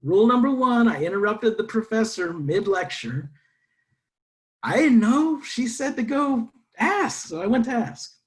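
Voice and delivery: high, loud, emphatic storytelling; animated confession. Real, not acted. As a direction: stress the blunder with wide-eyed energy. Sheepish excited confession to audience.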